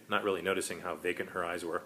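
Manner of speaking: ordinary delivery, nothing marked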